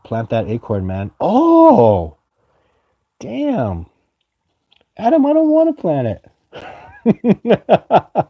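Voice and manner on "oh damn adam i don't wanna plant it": high, whining mock protest; squeaky, sulky refusal; playful whine. Real, not acted. Chat tells him to plant acorn.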